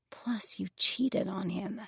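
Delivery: a slow hushed reproach; whispered accusation. Acted.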